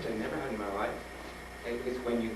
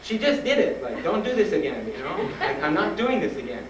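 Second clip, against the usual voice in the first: retold protest; 5 semitones higher, 3 dB louder than usual. Repeating protest closer to doctor's face.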